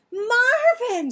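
high, loud alarmed scream of a name. A narrator's reading. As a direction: shriek as he falls. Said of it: "screamed Patsy".

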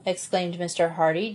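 ordinary delivery

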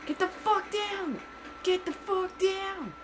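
high-pitched exasperated cursing; frustrated squeal. Real, not acted. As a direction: curse high and strained at the game. Fighting in the game.

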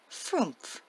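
small timid pixie voice, falling; wee little voice. Acted. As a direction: say it shyly like a tiny pixie. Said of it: "In a wee little voice answered".

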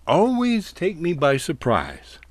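usual speaking voice

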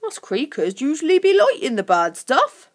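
a touch of goblin relish; keen creature. A narrator's reading. Creature tells Lucy what they like, keenly.

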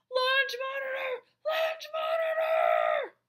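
very high, straining alarm shout. A narrator's reading. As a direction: shriek the warning, drawing out the repeat. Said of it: "He cried even more loudly".

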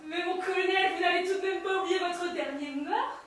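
accusing shout. Acted. Soldier confronting the colonel with a loud, high accusation. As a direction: shout the accusation in his face.

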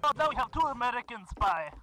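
hammed-up Russian accent, broken English; mock Russian accent, gamer joking; fake Russian accent. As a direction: play a comic Russian comrade in a Russian accent. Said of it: "tired of doing the voice".

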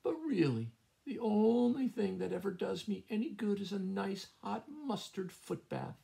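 a lightly voiced frail, fussy hen; feigned weakness. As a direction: play the poor invalid to fool the cat. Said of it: "she croaked in a weak voice".

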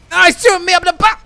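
fast, high, loud protest; panicked yell. Real, not acted. Player being shot from behind, panicked yell. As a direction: blurt it out under fire. Under fire in game.

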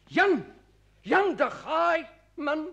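loud high joyful call of recognition; excited calling out. Acted. Old friend calling out in loud surprise. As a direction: hail the old friend with delight.

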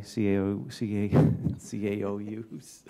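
false starts on the initials, a laugh breaking in; tongue-tied. Real, not acted. Councillor trips on Robert's title again in the report, tongue-tied. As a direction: fumble the letters, then draw out the last try. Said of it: "I'm tongue-tied on that one".

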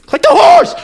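high frantic shout; panicked yell. Real, not acted.

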